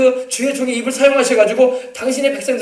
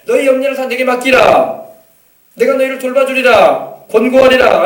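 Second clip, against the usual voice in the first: solemn proclaimed promise; 3 semitones higher, 7 dB louder than usual. Acted. Preacher voices God's promise.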